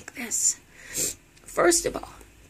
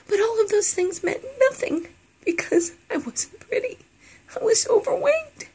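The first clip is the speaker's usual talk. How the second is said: laughing through tears; 14 semitones higher, 4 dB louder than usual. Real, not acted. Tearful confession of not pretty.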